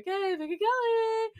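high sweet coaxing; sing-song kid voice. Retold or imitated. Little sister running the fortune game, high coaxing. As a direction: coax her in a syrupy kid voice.

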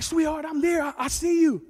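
high, loud, urgent call; retold shout of a desperate dad; father calling out. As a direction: call up to a child on a roof. Father calls up to daughter.

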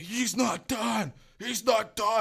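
high, drawn-out retold cry; mock drunken holler. Retold friends dragging drunk.

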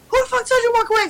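fast, high mock scolding; imitated yelling mom. Mom after child who walked away, mock yelling. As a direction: rattle off a high-pitched challenge. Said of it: "Now she yelling and shit".

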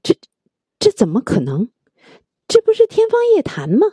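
stammered disbelief, leaping high on the last question; narrated shock building to a high-pitched question; astonished. A narrator's reading. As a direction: sputter in amazement, then pitch up incredulously. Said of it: "惊奇的差点要晕倒了".

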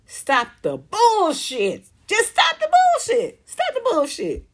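high squeaky mock voice, slow then shrill; blunt exasperated demand. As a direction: spell out the blunt version with fed-up mockery. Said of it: "very palatable way to say".

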